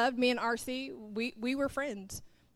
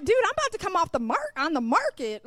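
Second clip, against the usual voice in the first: much higher, louder mock outrage; exasperated; 7 semitones higher, 5 dB louder than usual. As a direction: throw up your hands in disbelief. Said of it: "I'm like".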